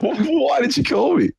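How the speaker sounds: hammed-up outrage at a pointless call; mock shout. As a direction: yell it in fake disbelief. Mock exasperated call reply.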